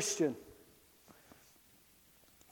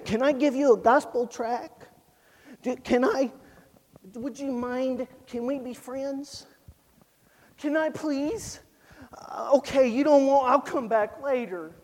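a hint of a timid mousy boy, softer; 4 dB quieter than usual. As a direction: play a meek little boy asking to be friends. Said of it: "like a little mousy boy and say".

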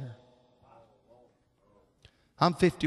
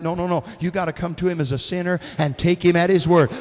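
normal talking voice

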